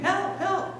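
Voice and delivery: very high, held-back scream for help; mock screaming. Boy keeps calling to the paddle wheel steamer, mock high scream. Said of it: "Frank keeps yelling and screaming".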